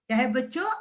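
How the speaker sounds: normal talking voice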